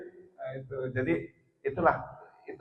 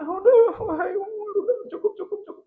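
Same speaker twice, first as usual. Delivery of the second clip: much higher, breaking plea to stop; tearful pleading; 4 dB louder than usual. Retold or imitated. Umar's friend, moved to tears, begs him to stop, high and tearful. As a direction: beg him to stop through mock tears. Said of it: "Nangislah gitu temannya".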